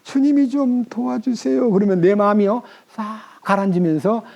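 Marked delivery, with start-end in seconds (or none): 0.0-1.7 high, slow plea for help; helpless prayer. Shown as a demonstration. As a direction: hold back anger and cry out to God. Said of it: "성질이 막 올라올라 그러는데". (높고 느린 간절한 도움 요청)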